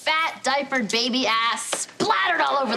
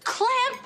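dripping faucet voice; 12 semitones higher than usual. Pretend. Mocks clamp sound, high squeak.

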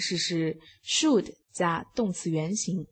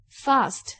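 "fast" with the British long "ah", fahst; teacher models "fast" the British way; British pronunciation; 5 semitones higher than usual. Shown as a demonstration. Teacher demonstrating accents for class, British "fast". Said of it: "英国英语".

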